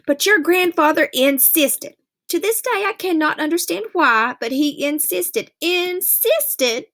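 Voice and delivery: a mother still baffled and annoyed; mother repeating her grievance, pitch climbing; exasperated Mama. Acted. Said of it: "She'd say".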